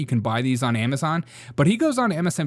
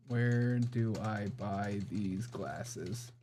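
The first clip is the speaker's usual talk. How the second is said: very slow, softer, dictated to the phone; slow voice search; 4 semitones lower, 12 dB quieter, much slower than usual. Shown as a demonstration. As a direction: speak each word slowly for voice search. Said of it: "Search for places".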